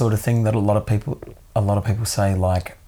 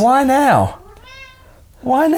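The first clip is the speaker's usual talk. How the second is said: high pet voice; 5 dB louder, slower than usual. Acted. Talking to the cat.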